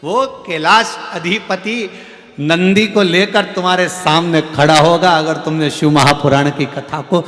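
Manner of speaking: loud, driving sermon delivery; fervent katha preaching; full-force preaching. Acted. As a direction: preach it out to the crowd.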